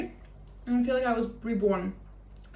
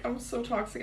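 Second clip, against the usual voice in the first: softer repeat; 7 dB quieter than usual. Real, not acted.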